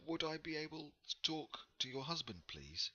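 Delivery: polite traveller explaining herself; lightly voiced Ingrid.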